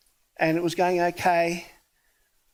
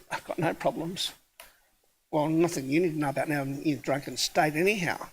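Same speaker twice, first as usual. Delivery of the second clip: softer and lower, a muttered retort under the breath; quiet low aside; 5 semitones lower, 5 dB quieter than usual. Retold or imitated. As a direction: drop the voice, dry and wry. Low aside to drunk man.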